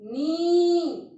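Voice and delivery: loud, long teaching नी; long nee. Shown as a demonstration. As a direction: stretch नी for the long ई. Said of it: "देखो नी".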